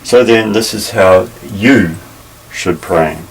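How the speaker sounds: "you" stressed and held, then a break before "should pray". Shown as a demonstration. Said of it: "putting the emphasis on the word".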